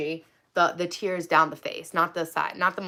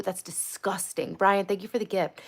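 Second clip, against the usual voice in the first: ordinary talk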